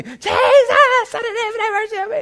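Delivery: very high, wild hollering. Acted. Blind man crying out for mercy, hammed-up shouting. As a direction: let it rip, way up high. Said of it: "He just gets louder and louder".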